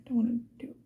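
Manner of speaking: ordinary talk, nothing marked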